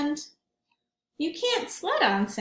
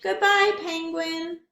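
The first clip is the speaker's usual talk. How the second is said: fond goodbye; 5 semitones higher than usual. Acted. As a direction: wave goodbye across the water. Crab farewell.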